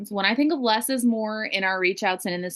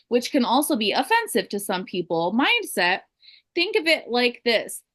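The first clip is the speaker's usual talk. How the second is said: usual speaking voice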